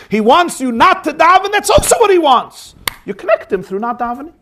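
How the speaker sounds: high, eager and persuasive; excited rebuttal. Retold or imitated. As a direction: lift him out of his sorrow with joy. Zusha cheerfully rebuts his brother.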